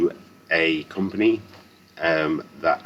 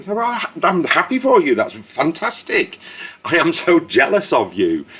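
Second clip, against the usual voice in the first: high-pitched, over-effusive delight; big swooping cry of congratulations; gushing congratulations; 10 semitones higher, 3 dB louder than usual. Acted. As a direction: gush it out, pitched way up. Reacting to commenters without the problem.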